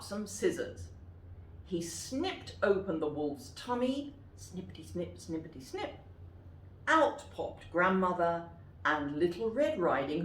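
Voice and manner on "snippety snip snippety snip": soft snipping. Acted. Sound of scissors snipping.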